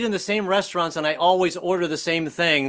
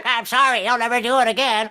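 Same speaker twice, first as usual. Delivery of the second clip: petulant parrot; 6 semitones higher than usual. Retold or imitated. Husband apologizing to his wife like a petulant parrot. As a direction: squawk it like a sulky parrot. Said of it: "sounding like a petulant parrot".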